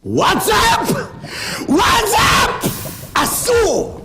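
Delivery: high, exclaimed warning; forbidding shout. Real, not acted. Preacher forbidding WhatsApp, high shouted exclamations. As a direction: exclaim each word high.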